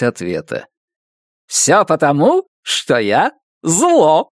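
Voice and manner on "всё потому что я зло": bright host voice, slowing on the last words; chirpy, drawn-out punchline; cheerful kids' TV host. A narrator's reading. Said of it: "весёлым голосом ведущего детской телепрограммы".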